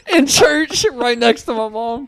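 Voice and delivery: high giggling through words; can barely speak for laughing. Real, not acted. High laugh then laughing talk.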